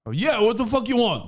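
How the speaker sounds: high, irritated challenge; brusque reply. Acted. Host snaps at rich stranger phoning him, high and irritated. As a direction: demand it impatiently of the caller.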